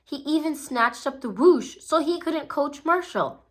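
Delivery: usual speaking voice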